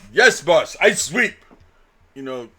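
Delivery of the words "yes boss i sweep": put-on loud, higher broken-English Russian accent; hammed-up Russian worker answering the boss; mock Russian accent. Worker Boris replying to boss, loud mock Russian accent. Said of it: "he'd be like".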